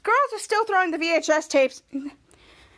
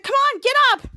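high, loud plea to get up; pitched-up, urgent mock panic; retold panicked urging; 9 semitones higher, 6 dB louder than usual. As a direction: urge them up in a retold panic. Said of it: "the girls panicked".

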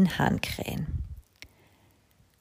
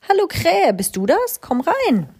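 high, louder happy cow call; cheerful cow; 12 semitones higher, 4 dB louder than usual. A narrator's reading. Mama Mu greeting the crow, high cheerful call. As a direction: call out to a friend at the window.

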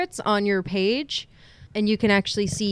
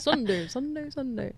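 announcer voice; 4 semitones higher, 7 dB quieter than usual. Pretend. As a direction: hype it like an arena announcer. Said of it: "W W E announcer".

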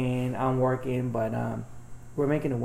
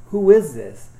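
louder, pitched-up annoyed who is this; annoyed retold demand; 6 semitones higher, 9 dB louder, slower than usual. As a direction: demand to know who's texting. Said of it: "I was getting pissed off".

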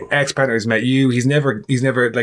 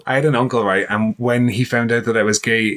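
ordinary talk

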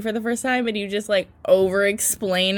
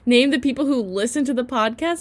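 usual speaking voice

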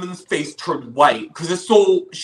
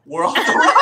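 laughing, voice jumps high; giddy laughing punchline; 3 dB louder than usual. Real, not acted. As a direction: crack up on the last words. Joking about fleeing to America.

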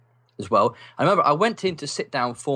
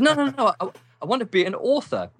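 eager protest; 11 semitones higher than usual. Retold or imitated. Teenage boy insisting to the careers advisor, high and eager. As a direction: cut him off eagerly, pitched high.

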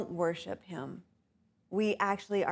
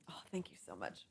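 whispered thanks, much lower and softer; 12 semitones lower, 17 dB quieter, faster than usual. Real, not acted. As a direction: breathe the thanks off-mic. Thanks someone for a mint mid-talk.

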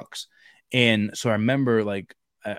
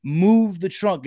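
raised, higher yell at the driver; retold scream; 9 semitones higher, 6 dB louder, slower than usual. Soldier yelling at an Afghan driver to get back in the truck, loud and high. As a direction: bark it like a soldier under fire. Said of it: "and I'm screaming".

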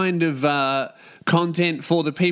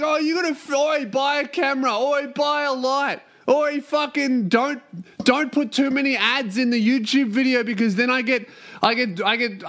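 high, whining mock-complainer voice; mocking YouTube commenters; 5 semitones higher than usual. Host imitating YouTube viewers griping about lights and ads, mocking. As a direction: parody nagging viewers, pitched well up. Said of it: "are like".

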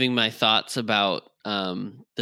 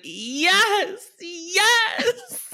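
very high breathy ecstatic moans; hammed-up shampoo commercial moan; shampoo ad ecstasy. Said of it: "Herbal Essence's commercials from the late nineties".

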